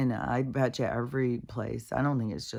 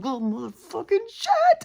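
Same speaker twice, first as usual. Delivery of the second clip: very high, louder strained cursing; acted-out squealed burst of frustration; exasperated outburst; 6 dB louder than usual. Walker losing patience with tangled dogs, high exasperated outburst.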